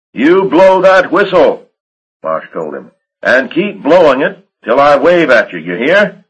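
calling out orders. Acted. Marsh shouting up to Kitch to blow the whistle, loud. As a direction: bellow it so he hears over the noise.

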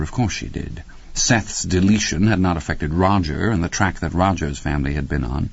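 plain reading voice

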